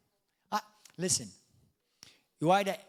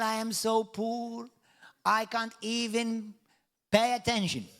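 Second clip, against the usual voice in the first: slow, higher, self-pitying moan; mock poor complainer; 5 semitones higher, slower than usual. Preacher mocks a poor man's excuse to the church, self-pitying. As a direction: play up the poor man's lament. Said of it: "Let the poor say".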